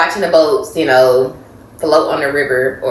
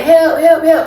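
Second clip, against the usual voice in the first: high, loud call for help; 12 semitones higher, 3 dB louder, slower than usual. Retold or imitated. Calling to passing boats at night.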